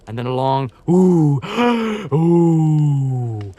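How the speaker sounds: a long, loud hooting moose-call "ooh"; imitated moose call. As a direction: mimic the lonely call, drawn out. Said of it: "Three short grunts".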